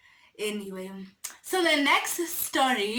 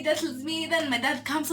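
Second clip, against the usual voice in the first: ordinary delivery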